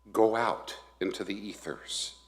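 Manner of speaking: ordinary talk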